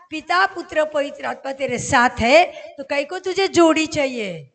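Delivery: loud, insistent divine reply; God's emphatic reply. Retold or imitated. The Lord answering her prayer for a partner, emphatic challenge. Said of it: "तो प्रभु क्या बोला?".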